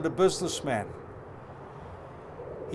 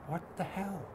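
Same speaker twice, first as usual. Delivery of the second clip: muttered aside; 13 dB quieter than usual. Real, not acted. Aside on his own journeys.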